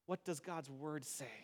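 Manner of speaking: soft and low, humbly asking; hushed, humble asking; humble quiet question. Shown as a demonstration. Preacher shows how to ask humbly, softly. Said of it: "humbly ask".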